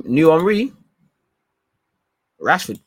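"Henry" said the French way, Ongri with no H, punched loud and high; hammed-up French accent on "new Henry", rising. Streamer hyping Rashford as the new Thierry Henry, French accent. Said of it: "better take that French accent off".

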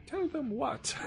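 put-on high, soft puzzled old lady voice; mock old lady. Mother baffled by her son's tagline, high mock old-lady voice. Mom voiced in retold story.

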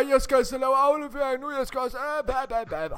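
high, put-on tired whine; a friend mocking his tired teammate; mocking whiny voice. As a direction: mimic a sleepy teammate begging off. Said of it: "så siger vi".

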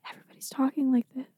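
quick, hushed mock movie whisper. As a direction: imitate actors whispering every line. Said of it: "why is everyone whispering".

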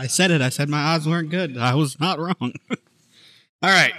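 ordinary delivery

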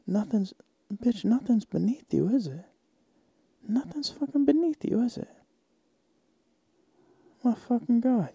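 high, hushed scolding of the customer; mock outrage. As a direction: sneer at them in a high hush.

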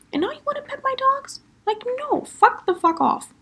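very high-pitched, scandalised outburst; retold incredulous fury. Retelling refusing to let the ex pet the dogs, outraged.

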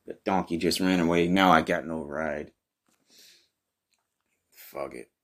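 normal talking voice

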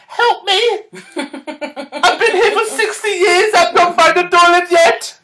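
put-on high, slow, pleading old man; high old man wail. Old man in pub toilets begging for help, high slow wail. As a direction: wail it like a lost old man stuck for years.

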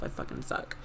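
unvoiced, half-muttered self-dig; whispered aside. Real, not acted. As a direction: drop to a whisper. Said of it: "muttered self-deprecation".

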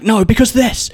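high, heated mock retort; arguing back. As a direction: imitate jumping into an argument. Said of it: "argue back".

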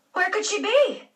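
high, startled cry; alarmed exclaim. A narrator's reading. Aunt Daisy finding Phoebe's bed empty, alarmed. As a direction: gasp it out in sudden worry. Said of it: "Aunt Daisy exclaimed".

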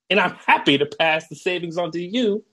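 ordinary talk, nothing marked

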